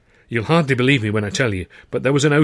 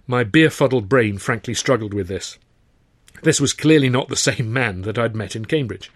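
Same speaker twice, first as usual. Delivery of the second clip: ordinary talk, nothing marked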